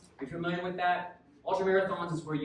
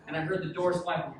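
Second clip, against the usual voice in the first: usual speaking voice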